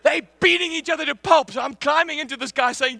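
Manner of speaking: ordinary talk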